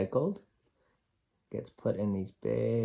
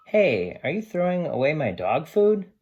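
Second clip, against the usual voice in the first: a lightly voiced alarmed dog, raised higher; indignant surprise; 8 semitones higher, 10 dB louder than usual. Sam the dog catching them throwing out his food, mildly alarmed. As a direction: protest like a pet who sees his dinner go.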